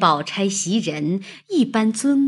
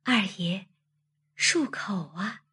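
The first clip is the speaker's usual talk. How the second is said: soft, shy maid's voice; 轻轻地说; 6 dB quieter than usual. A narrator's reading. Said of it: "只得轻轻地说道". (羞怯地轻声说)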